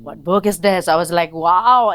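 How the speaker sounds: loud, awed excitement; amazed. Retold or imitated. Woman retelling her discovery of a book, amazed. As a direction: burst out in wonder at a discovery.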